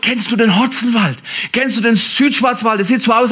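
normal talking voice

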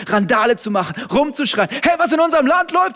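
loud, high rising sermon cry; a preacher raising the alarm at the top of his voice; full-force preaching. Acted. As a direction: shout it out over the crowd.

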